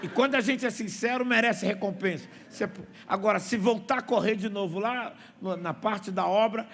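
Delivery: ordinary talk, nothing marked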